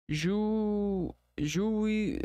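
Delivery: "Ju-, juí-" split into separate syllables, pitch up; pulled apart, syllable by syllable. Shown as a demonstration. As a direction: break juízo into its syllables. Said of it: "tenta fazer juízo".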